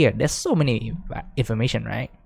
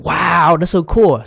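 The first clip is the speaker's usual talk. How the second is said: louder, higher mock wow; imitated student awe; 5 semitones higher, 5 dB louder, slower than usual. Teacher voicing an amazed student, mock wonder. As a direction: play the amazed student, lightly. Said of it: "And you're like".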